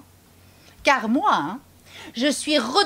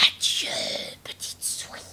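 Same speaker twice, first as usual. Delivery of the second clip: snake whispering goodbye in fright; frightened whisper; 5 dB quieter than usual. Acted. Snake fleeing the gruffalo, whispering in terror. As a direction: hiss out a scared farewell.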